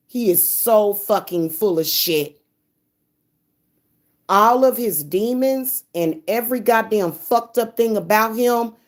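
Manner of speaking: normal talking voice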